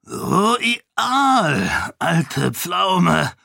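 a hearty booming call across the room. A narrator's reading. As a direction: boom it out like a big jovial count. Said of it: "erscholl eine polternde Stimme". (polternd und dröhnend gerufen)

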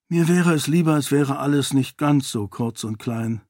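plain reading voice

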